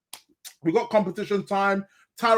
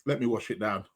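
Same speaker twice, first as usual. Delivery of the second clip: mouth full; 7 semitones lower, 8 dB quieter than usual. Real, not acted. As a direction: still swallowing food. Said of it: "so I'm still eating".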